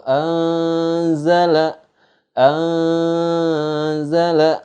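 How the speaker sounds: "anzala" chanted high and slow, its n a long hum; ikhfa recitation. Shown as a demonstration. Teacher gives another example, "anzala" with a long veiled n. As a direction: draw out the masked n in "anzala". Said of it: "Nun mati ketemu huruf".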